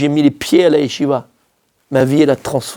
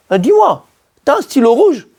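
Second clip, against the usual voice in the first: higher, a hint of a classmate asking; mock classmate; 8 semitones higher than usual. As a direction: lightly mimic a casual classmate.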